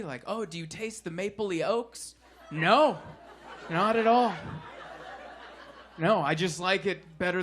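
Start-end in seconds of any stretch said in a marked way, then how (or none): 0.3-2.2 softer, snobbish sniff; mock a pretentious taster; snooty beer snob. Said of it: "People that are all snooty".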